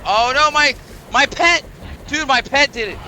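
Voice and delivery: high, loud hammed-up blame; mock panic. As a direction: yelp it out, pointing at the pet. Blaming his pet, mock panic.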